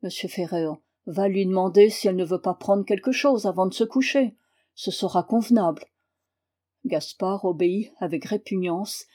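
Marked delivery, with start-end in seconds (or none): none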